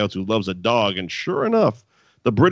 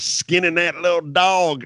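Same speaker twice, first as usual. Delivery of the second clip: put-on Southern accent; 6 semitones higher, slower than usual. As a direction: lay on a mock Southern accent. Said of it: "dirtier with the Southern accent that he has".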